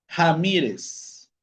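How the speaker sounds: slow "Ramírez" starting on a Spanish J; initial R as J. Shown as a demonstration. Teacher modelling Portuguese initial R for students, slow J-sound demo. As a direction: draw out "Ramírez" with the first R as an H-like jota. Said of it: "la R va a tener un sonido de J".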